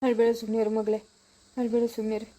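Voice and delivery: soft, low soothing; father calming his crying daughter softly; gentle comfort. Acted. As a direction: hush her tenderly.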